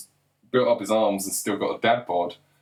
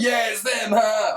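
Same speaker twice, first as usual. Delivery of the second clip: high-pitched, gushing fan cheer; mock excited fan; 12 semitones higher, 3 dB louder, slower than usual. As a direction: squeal it like a thrilled follower, mockingly. Voicing fans watching videos, mockingly thrilled.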